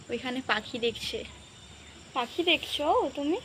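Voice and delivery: mother softly murmuring to her son about a bird; hushed baby talk. Acted. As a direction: murmur to the little one watching a bird. Soft talk to baby outside.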